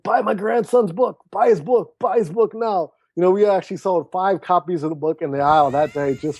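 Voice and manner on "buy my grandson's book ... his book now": higher, eager calls to passersby; lightly voiced proud grandpa. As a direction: hawk the book like a proud grandfather. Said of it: "he's like".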